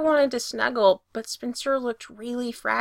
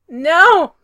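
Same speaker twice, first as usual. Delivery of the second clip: higher and louder, a bit hysterical; a little hysterical; 7 semitones higher, 12 dB louder than usual. A narrator's reading. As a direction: blurt it out in a panic. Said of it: "a little hysterically".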